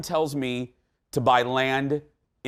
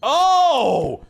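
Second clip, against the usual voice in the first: excited exclamation; 11 semitones higher, 8 dB louder than usual. Real, not acted. Excited host reacting to Duluth.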